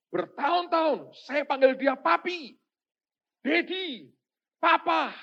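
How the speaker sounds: loud, high acted cry; anguished retold complaint, high and drawn out; frustrated outburst. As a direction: cry out each name at full force. Acts frustrated man's outburst to preacher.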